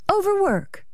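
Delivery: very high, soft disbelief; incredulous echo. Acted. Wife doubting her husband's excuse, incredulous and high.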